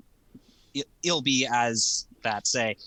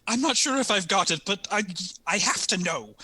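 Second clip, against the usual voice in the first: higher, strained-sounding plea in character; anxious insistence; 7 semitones higher than usual. Acted. Ilby answering a friend's reassurance, high anxious insistence. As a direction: push the pitch up, unsure yet insistent.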